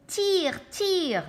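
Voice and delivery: high, loud urgent call; urgent cry. Acted. Scooby cries out urgently to pull the door. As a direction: shout it while straining. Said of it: "dit Scooby".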